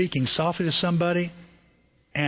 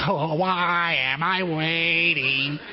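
slow, drawn-out mock impatience; imitating an annoyed customer, lightly; impatient sigh; much slower than usual. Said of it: "I must have that look like".

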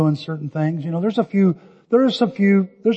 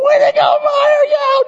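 high, loud whooping cheer; cheering shout; 5 dB louder than usual. Retold or imitated. Grandpa cheering Meyer after a shot to the face, loud high shout. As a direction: cheer your goalie grandson on. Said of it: "and I'm going".

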